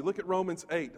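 ordinary delivery

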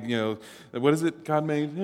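normal talking voice

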